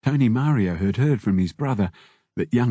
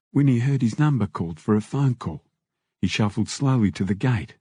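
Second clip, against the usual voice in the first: plain reading voice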